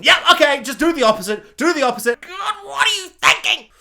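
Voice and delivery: loud, high exasperated outburst. Real, not acted. Ranting at the showrunners, loud, high exasperation. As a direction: vent at the showrunners. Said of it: "exasperated rant".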